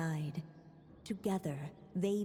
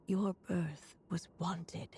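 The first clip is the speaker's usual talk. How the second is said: tender whisper. Acted. A creator reassuring her child, whispered tenderly. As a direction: breathe the promise gently to a child.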